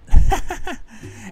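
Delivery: short unvoiced chuckle at himself; breathy laugh. Real, not acted. Officer laughing at how well yelling worked, chuckle.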